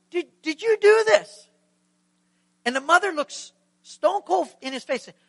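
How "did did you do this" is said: high, loud accusing manager; confronting manager. Acted. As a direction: demand an answer from the mother.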